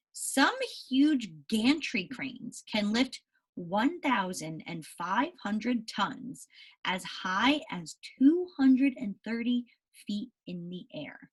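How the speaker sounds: slow, careful read-aloud voice; reading to children. Acted. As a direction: read the story slowly to the class. Reads story aloud to class.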